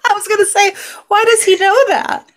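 very high, squeaky with laughter; laughing while talking. Real, not acted. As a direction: get the question out through real laughter. Talking through laughter at friend's joke.

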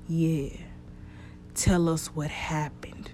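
slow, soft and breathless, almost a whisper; a ghost moaning the words back at him; eerie whispered echo of the question; ghostly moan. Acted. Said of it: "Noah moaned".

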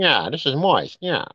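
a hammed-up tough guy from old films; Edward G. Robinson. Showing how people should say moist, as a gangster. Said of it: "like a, you know, Edward G. Robinson".

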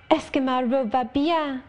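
put-on high, bright child's French; bounding forward, asking eagerly about her dress; Adele crying out. Little Adele showing off her dress, eager and high. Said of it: "cried she".

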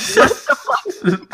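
high, slow, laughing outburst; laughing disbelief. Retold or imitated. Sleepy caller startled by the plane remark, laughing disbelief. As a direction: crack up while saying it. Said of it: "I just start laughing".

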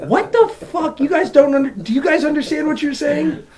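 high-pitched, incredulous questioning; baffled outburst. Acted. Listener asking British rappers to explain, high bafflement. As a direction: pitch it way up in mock disbelief.

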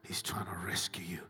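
soft, low and gentle; tender reassurance. Real, not acted. Tender reassurance of God's love.

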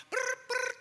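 very high buzzing brr brr; imitated phone buzz. Preacher mimics the phone going off.

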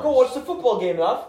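loud, high mock British accent. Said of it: "let's try a different accent".

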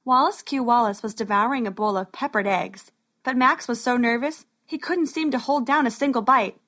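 ordinary delivery, nothing marked

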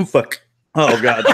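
coughing, choking on spit. Real, not acted. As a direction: sputter through a cough after choking on spit. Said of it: "Choking on my spit".

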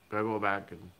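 easygoing, lightly put-on Vermont accent; old-time Vermont accent. Old-time Vermonter off to the trees, light Vermont accent. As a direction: slip a little into rural Vermont speech. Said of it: "hanging out with some old-time Vermonters".

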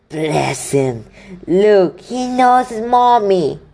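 high, loud, slow gushing giant; gushing fond voice; doting Hagrid. Acted. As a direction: coo over the baby dragon like a proud parent. Hagrid doting over baby dragon.

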